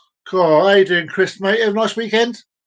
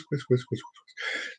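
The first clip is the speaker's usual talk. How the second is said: fading echo; 6 semitones lower, 12 dB quieter than usual. Pretend. Echo effect on word.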